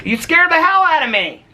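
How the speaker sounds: high, loud startled outburst. Real, not acted. Startled by her husband at the blinds, high and loud. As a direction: jump and blurt it out, high and loud.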